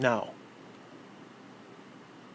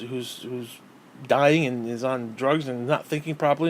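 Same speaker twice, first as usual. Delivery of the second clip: usual speaking voice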